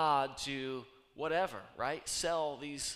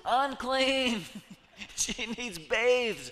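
mock warning call; 9 semitones higher, 9 dB louder, much slower than usual. Joking about Nora needing a bath.

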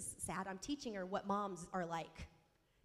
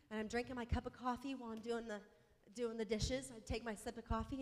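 normal talking voice

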